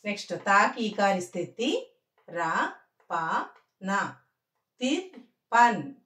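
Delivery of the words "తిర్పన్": slow, higher తిర్పన్ in two clear beats; careful teacher's model of తిర్పన్; pronunciation demo. Shown as a demonstration. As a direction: split తిర్పన్ into tir and pan. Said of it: "తిర్పన్ అని చదవాలి".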